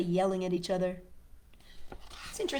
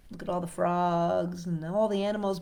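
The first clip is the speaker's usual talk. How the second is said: ordinary delivery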